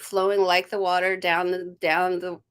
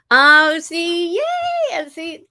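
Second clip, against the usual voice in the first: higher, louder delighted cry; excited "oh see, yay"; 9 semitones higher, 8 dB louder, slower than usual. Real, not acted. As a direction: burst out in joy. Excited at card drawn.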